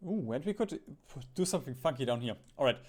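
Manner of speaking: ordinary delivery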